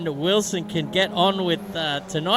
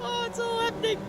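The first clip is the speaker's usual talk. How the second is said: squeaky, much higher put-on voice, softer; mock catchphrase; 6 dB quieter than usual. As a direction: toss the catchphrase off in a silly high squeak.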